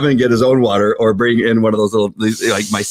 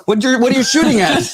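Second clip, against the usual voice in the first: startled. Retold or imitated. Startled cop quoted in shooting story.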